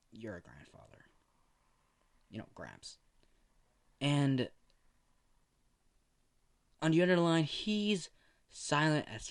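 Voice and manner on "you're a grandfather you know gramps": whispered news. Acted. Doctor breaks news softly.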